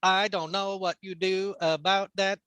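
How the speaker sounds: exaggerated put-on Chinese accent; over-the-top Chinese accent. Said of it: "a really over the top".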